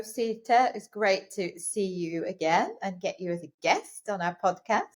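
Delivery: ordinary delivery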